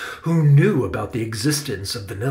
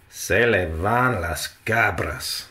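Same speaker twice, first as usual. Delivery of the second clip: exclaiming in Spanish; 4 semitones lower, slower than usual. A narrator's reading. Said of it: "exclaimed Salvador".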